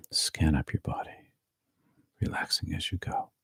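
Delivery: hushed body-scan guidance; guided meditation whisper. Acted.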